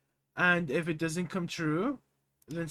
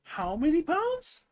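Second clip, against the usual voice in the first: surprised; 13 semitones higher, 7 dB louder, slower than usual. Real, not acted. Stunned question at weight loss.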